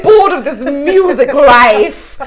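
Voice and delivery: high, whiny mock of her teenage self; mock bored teenager. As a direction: send up your own spoiled seventeen-year-old. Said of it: "And I was like".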